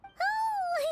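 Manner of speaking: high, startled oh; a sudden high gasp of surprise; surprised oh. Acted. Paimon reacting to the sight of town, surprised.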